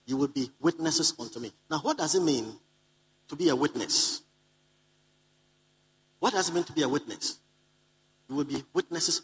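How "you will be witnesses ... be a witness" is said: ordinary talk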